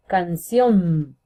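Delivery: stretched, stressed final syllable in Canción; stress on last syllable. Shown as a demonstration. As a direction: lean long on the "ción" of Canción. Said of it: "última sílaba".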